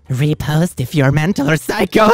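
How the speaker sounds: hammed-up, rising delivery; mock edgy poster. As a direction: read it like an overdramatic chain post. Reads post in put-on voice.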